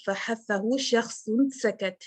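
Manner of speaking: slow breathy letters of hams; breathy recitation. Shown as a demonstration. Teacher reciting the hams letters: slow airy. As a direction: let air flow on each letter. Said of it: "ہمس".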